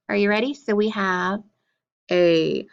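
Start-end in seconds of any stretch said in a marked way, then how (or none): 2.1-2.7 letter name dropped low. Shown as a demonstration. Teacher reciting a vowel for pupils, low voice.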